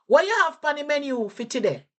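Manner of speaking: high, fast Jamaican patois question. Shown as a demonstration. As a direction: ask the waiter in quick patois. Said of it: "The question you'll ask in Jamaican patois is".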